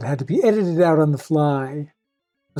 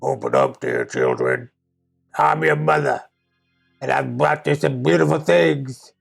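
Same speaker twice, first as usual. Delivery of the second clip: gruff wolf; 2 semitones lower than usual. Acted. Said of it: "by his gruff voice".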